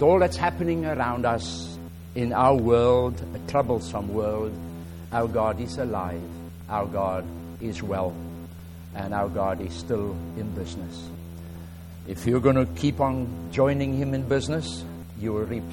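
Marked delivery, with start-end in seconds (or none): none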